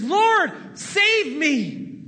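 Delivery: desperate cry. Retold or imitated. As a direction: shout it like a man going under. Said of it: "But then Peter says".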